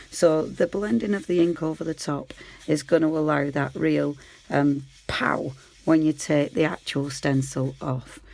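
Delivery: usual speaking voice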